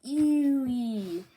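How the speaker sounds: a high, loud, grossed-out squeal; disgusted ew. Real, not acted. Reacting to Wapol's outfit, grossed-out squeal. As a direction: recoil from something gross.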